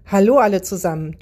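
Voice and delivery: usual speaking voice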